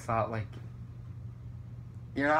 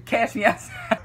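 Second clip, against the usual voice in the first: loud, higher meme catchphrase; catchphrase demo; 8 semitones higher, 6 dB louder than usual. Shown as a demonstration. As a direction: call out the line for a friend to copy. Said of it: "Look into the camera and say".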